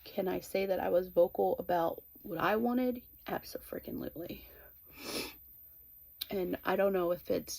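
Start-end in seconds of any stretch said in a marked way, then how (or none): 3.3-4.4 whispered with playful emphasis; emphatic whisper. Real, not acted. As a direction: hiss it out as a punchline. Emphatic whispered answer to herself.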